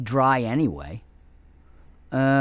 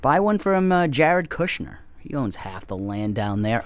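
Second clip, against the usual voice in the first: ordinary talk, nothing marked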